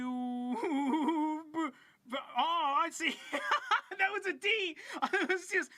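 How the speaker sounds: laughing. Real, not acted. Laughing at game over.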